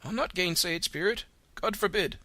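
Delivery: put-on high, quavering old man conceding; an old miser softened, pleading; Scrooge, moved. A narrator's reading. Scrooge yielding to the Spirit, high and moved.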